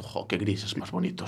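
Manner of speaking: soft, slow, low mock admiration; mock dreamy gush. Teasing a friend who admires greys, in a soft dreamy mock voice. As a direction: sigh it out like a dazzled fan. Mocking a friend's gushing reaction.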